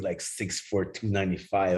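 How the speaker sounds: usual speaking voice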